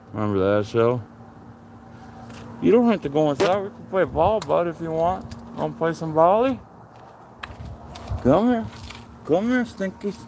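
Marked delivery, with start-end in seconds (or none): 2.6-10.2 dog talk. Real, not acted. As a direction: coax the pups to play, pitched way up. Baby talk to the dogs.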